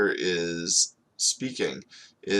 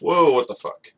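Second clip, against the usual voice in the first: loud, high startled outburst; shocked reaction; 6 semitones higher, 7 dB louder than usual. Acted. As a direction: blurt it out as the shock lands. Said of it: "it takes a second for him to realize it and go".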